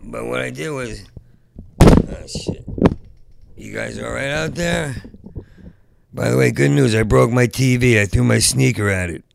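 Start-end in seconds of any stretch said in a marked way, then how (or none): 1.8-2.7 sudden loud breathy oh shit; startled exclamation. Real, not acted. 6.1-9.2 loud proud announcement. Real, not acted.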